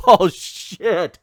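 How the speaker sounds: real laugh breaking into a loud curse; laughing in disbelief. Real, not acted. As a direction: caught off guard, cracking up. Cracking up at racist dialogue.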